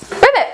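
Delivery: high, quick pretend croak; playful high-pitched frog call; frog ribbit. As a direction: imitate a frog's ribbit.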